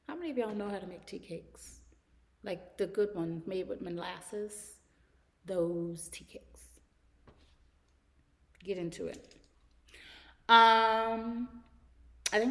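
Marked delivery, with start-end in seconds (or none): 5.5-6.6 slow, whispered with relish. Acted. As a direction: whisper it like a treasured secret. Fond memory of molasses tea cakes.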